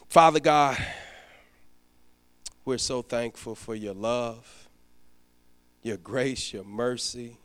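low, steady prayer voice; pastoral prayer. Acted. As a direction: pray earnestly over the standing saints. Leads closing prayer.

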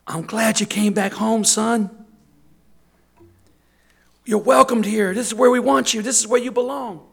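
the father embraces him, loudly glad; father's welcome. Acted. The father welcoming his son back home, loud and joyful.

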